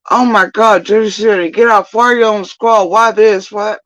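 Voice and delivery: high, loud mock scolding; frantic scolding. Foos scolding him in a panic, high mock nagging. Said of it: "Foos tells me".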